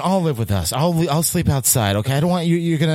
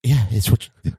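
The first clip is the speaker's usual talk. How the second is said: ordinary talk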